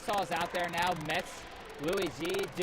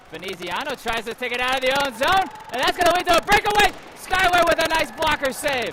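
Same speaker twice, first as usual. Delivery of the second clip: rising excited hockey call, faster; 8 semitones higher, 11 dB louder than usual. Acted. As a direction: build to a shout on the breakaway. Breakaway call in play-by-play.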